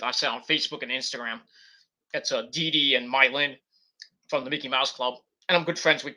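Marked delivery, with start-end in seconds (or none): none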